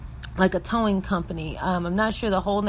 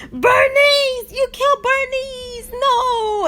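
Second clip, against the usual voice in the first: high wailing over the name; keen over the friend's body; anguished wail; 4 dB louder, slower than usual. Retold or imitated. Dave mourning Bernice at the girls, high mock wail. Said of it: "He said".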